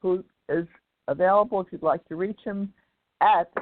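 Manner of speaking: ordinary talk, nothing marked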